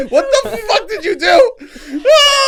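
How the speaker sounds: high, loud shriek through laughter; laughing mock outrage. Real, not acted. Guest blaming host for the episode, shrieking laughing protest. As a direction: wail it out while cracking up.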